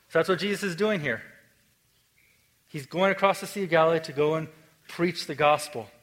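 ordinary delivery

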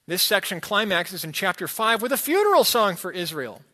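ordinary talk, nothing marked

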